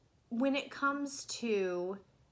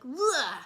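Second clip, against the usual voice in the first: loud, higher "ugh" of disgust; disgusted groan; 5 semitones higher, 9 dB louder than usual. Retold or imitated. Film reviewer recoiling from a bad dialect, disgusted groan. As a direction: groan as if yanked out of it. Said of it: "I was just like".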